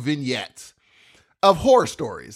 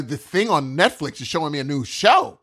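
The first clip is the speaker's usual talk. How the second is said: ordinary talk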